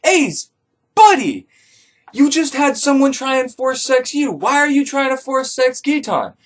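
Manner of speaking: high, incredulous reproach; a disbelieving telling-off; exasperated scolding. Retold or imitated. Narrator scolds Ace for attacking Giton, exasperated.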